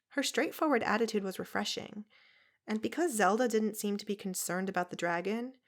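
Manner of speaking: normal talking voice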